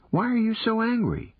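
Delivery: put-on young girl voice asking the sprite; curious young girl. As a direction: ask it like a teenage girl, half teasing. Girl questions her companion.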